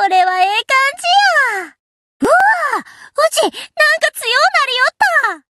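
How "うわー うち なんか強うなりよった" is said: excited. Acted. Ship girl thrilled after her remodel, shouting excitedly. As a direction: shout with thrilled surprise at her new strength. Excited about getting stronger.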